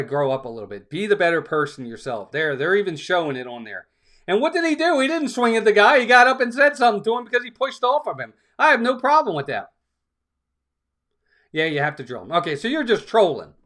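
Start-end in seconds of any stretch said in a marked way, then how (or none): 4.3-9.7 pitched high and pushing hard; heated rebuttal. Real, not acted. Streamer rebutting a troll in chat, heated and high. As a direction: fire back, voice climbing high.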